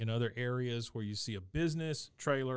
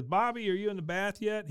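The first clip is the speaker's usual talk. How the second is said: calling out; 9 semitones higher than usual. Retold or imitated. Mother calling to son in the bath, high call out.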